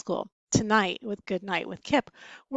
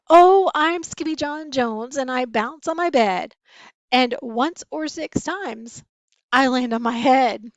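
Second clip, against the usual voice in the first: bouncy sing-song; 4 semitones higher, 9 dB louder than usual. Acted. Cat sings bouncing on bed.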